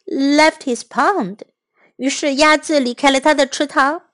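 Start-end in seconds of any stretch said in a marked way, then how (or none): none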